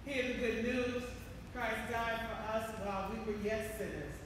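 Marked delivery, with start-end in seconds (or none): none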